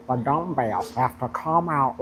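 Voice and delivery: slow, lightly drawled coach line; imitating a Southern drawl. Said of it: "he talk like this".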